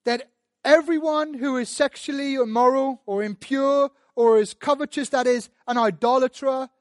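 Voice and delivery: loud, high, fervent preaching; preaching at full force. Real, not acted. Preacher pleading with sinners, loud and fervent. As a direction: drive it home to the congregation.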